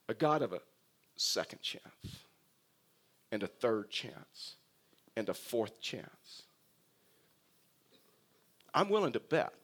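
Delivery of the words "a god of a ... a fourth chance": soft, slow, almost whispered; hushed preaching. Acted. Preacher to the congregation on God's mercy, slow near-whisper. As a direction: let each chance fall quietly, slowly.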